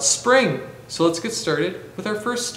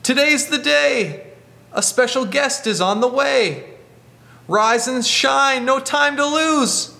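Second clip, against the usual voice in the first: put-on higher, excited little boy voice; higher wake-up call from William; 6 semitones higher, slower than usual. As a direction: call the animals awake brightly. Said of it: "And William says".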